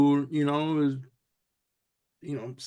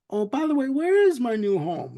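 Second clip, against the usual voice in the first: high, sweet, innocent question; voices; 8 semitones higher, 4 dB louder than usual. Retold or imitated. Charlie asking the gentleman about his home, high and sweet. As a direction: ask sweetly like a pampered pet. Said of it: "he's like".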